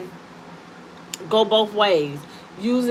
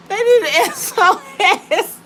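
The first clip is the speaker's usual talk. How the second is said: laughing; 10 semitones higher, 4 dB louder than usual. Real, not acted. Laughing fit.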